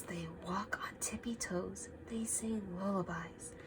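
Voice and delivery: soft unvoiced storytelling whisper. A narrator's reading. Reading to kids while the bear sleeps, whispering.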